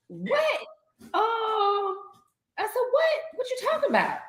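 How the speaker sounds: high, baffled what; retold disbelief. Sister baffled by talk of a big pill, high disbelief. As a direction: pitch it way up in confusion. Retelling her baffled reaction.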